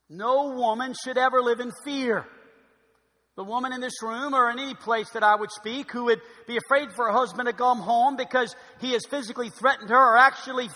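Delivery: loud, high, emphatic declaration; forceful preaching. Real, not acted. Preacher warning women against living in fear, loud and forceful. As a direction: build in force to an emphatic final command. Preacher condemns physical abuse.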